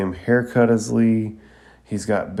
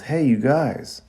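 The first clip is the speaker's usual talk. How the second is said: mild call-out, pitched up; retold yell; 5 semitones higher than usual. A narrator's reading. Naruto running up to his friends, lightly yelling. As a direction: call to your friends without full volume. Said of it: "runs up to Sakura and Sasuke and yells".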